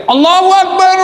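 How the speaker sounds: high ringing prayer call; caller's cry. Acted. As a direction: cry it out to the back rows. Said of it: "Ada yang teriak".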